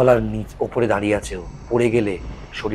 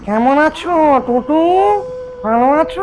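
nasal ghost voice; 4 dB louder than usual. Acted. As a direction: speak through the nose like a ghost. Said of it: "Bipin খোনা গলায় বলে উঠল".

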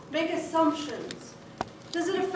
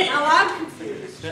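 laughing confusion; 6 dB louder than usual. Real, not acted. Laughing aside.

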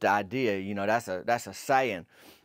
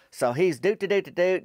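mock harmonica tune, higher and louder; playful doot-a-doot harmonica noise; harmonica doot imitation; 5 semitones higher, 4 dB louder than usual. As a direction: toot it out like a harmonica. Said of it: "harmonica like that away".

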